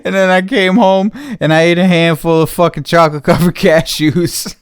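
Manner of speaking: loud, giggling admission; laughing confession. Real, not acted. Telling viewers about the cashews while laughing.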